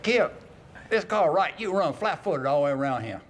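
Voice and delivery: high-pitched imitation of a Virginia accent; put-on Virginia accent, high and rushing at the end. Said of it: "in his Virginia accent".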